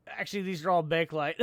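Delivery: ordinary talk, nothing marked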